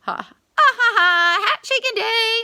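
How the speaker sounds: high, loud frantic laugh-song; hooting sing-song. A narrator's reading. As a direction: hoot and holler it. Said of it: "Anansi sang".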